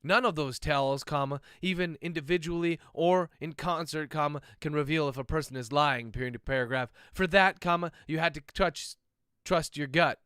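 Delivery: reading aloud, speaking the punctuation; dictating. Acted. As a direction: dictate it, naming every comma and period.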